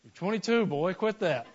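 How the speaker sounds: scolding dad. Retold or imitated. Dad to his grown son who screamed, mock-stern scolding.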